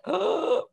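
a sharp, high imitated gasp of surprise; mock kids' gasp. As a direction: gasp like startled kids. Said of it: "they were all like".

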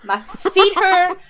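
a drawn-out complaint about sore feet; mock moaning. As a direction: drag it out like a weary grumble. Moaning about being tired and pregnant.

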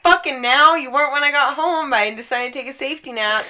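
high, loud reenacted tirade; outraged yelling. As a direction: scold the cat at full volume. Acted scolding of the cat in labor.

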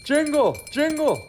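high, loud summons; calling out. Acted. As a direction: call urgently for your helper. Santa summons his elf helper.

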